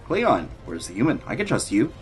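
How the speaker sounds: ordinary talk, nothing marked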